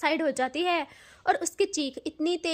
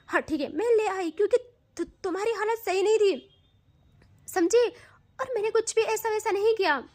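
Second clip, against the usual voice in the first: higher, louder, exasperated defence; 6 semitones higher, 4 dB louder than usual. Acted. Tanu insists to Siya she did nothing, loud exasperated defence. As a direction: push back hard, voice up and loud.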